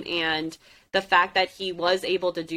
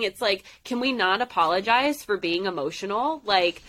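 normal talking voice

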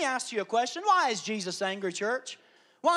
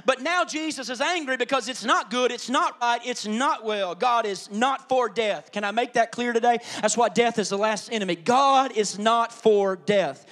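emphatic preaching; 5 dB louder than usual. Acted. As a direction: preach each not like a hammer blow. Preaching at full force.

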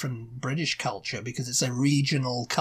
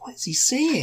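high, puzzled blurt; bewildered question about what he said; baffled; 10 semitones higher than usual. Retold or imitated. Confused about brother's speech.

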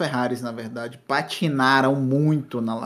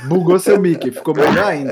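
distorted broken mic sound; like a bugged microphone; glitched mic; 2 semitones higher, 6 dB louder than usual. Real, not acted. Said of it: "cê ficou com voz de Megatron".

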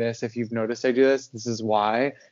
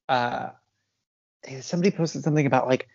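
normal talking voice

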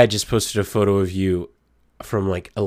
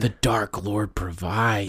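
mock solemn; 7 dB quieter, much slower than usual. Catchphrase intoned solemnly.

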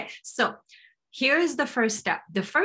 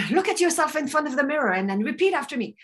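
lightly acted coach, higher and quick; brisk, higher-pitched coach giving instructions; mock coach instruction; 6 semitones higher, faster than usual. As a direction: lead a client through it, quickly. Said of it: "for me to say".

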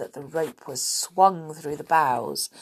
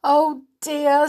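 sobbing; 9 semitones higher, 7 dB louder than usual. Acted. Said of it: "sobbed Silky".

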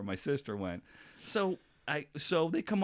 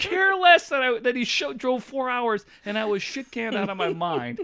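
raised, loud, incredulous; loud exasperation; 12 semitones higher, 10 dB louder than usual. Real, not acted. Son loudly mocks his dad's double standard, raised. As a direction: belt it out in mock disbelief. Mock-outraged retelling of dad.

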